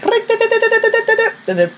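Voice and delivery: a high steady electronic beep, like a heart monitor; monitor beep imitation. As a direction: mimic the hospital monitor beeping high and flat. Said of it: "you can hear the monitor being like".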